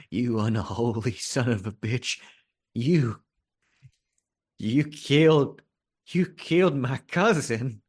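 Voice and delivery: put-on nervous drunk jabbing each word, rising in pitch; drunk gunman. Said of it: "he says".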